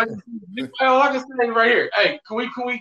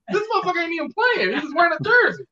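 high, fast, incredulous outburst; exasperated retort; 10 semitones higher, faster than usual. Retold or imitated. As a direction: fire back at hecklers in disbelief. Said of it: "I'm like".

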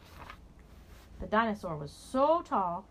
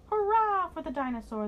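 high, loud cheer; 9 semitones higher, 5 dB louder than usual. Acted. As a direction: cheer it like happy kids. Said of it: "the children cried".

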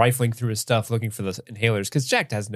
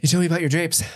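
wounded lover; 5 semitones higher, faster than usual. Pretend. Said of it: "Jack's like".

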